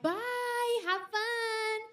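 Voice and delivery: high, sing-song goodbye; mom's cheery goodbye. Retold or imitated. Mom dropping her kid off at school, high and cheery. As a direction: chirp it brightly like a carefree mom. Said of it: "She'd be like".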